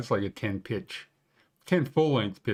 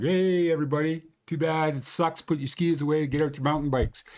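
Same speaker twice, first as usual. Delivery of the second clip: higher-pitched mock call-out to everybody; acted-out public announcement, pitched up; 5 semitones higher than usual. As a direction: break bad news in a high, breezy put-on voice. Acted quote, calling out to everybody.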